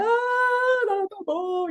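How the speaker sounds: high, wailing tantrum whine; whining child. Retold or imitated. A tantruming child whining at the parent, high and tearful. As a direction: play a toddler about to burst into tears.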